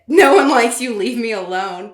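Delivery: loud, high blunt shout; harsh outburst. Acted. Nick yells at clingy Claudia. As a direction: yell it to get rid of her.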